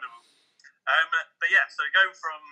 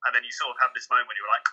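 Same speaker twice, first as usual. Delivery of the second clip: ordinary talk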